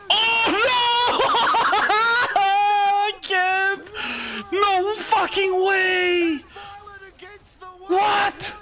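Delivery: high, laughing disbelief shout; shocked disbelief. Real, not acted. Streamer watching Marble survive, laughing shocked shout. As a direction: cry out that it can't be true.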